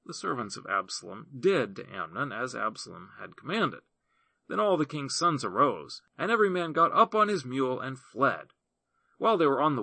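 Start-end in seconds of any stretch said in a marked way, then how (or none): none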